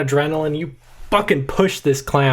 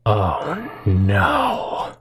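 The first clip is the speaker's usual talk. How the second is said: weary groan; 6 semitones lower than usual. Acted. Captain dreads the players.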